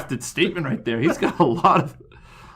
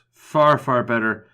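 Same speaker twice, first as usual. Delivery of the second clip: slowed down, relishing each word; slow savouring; slower than usual. Pretend. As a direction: draw out "Far, far better" slowly, like tasting it. Repeats it slowly, relishing.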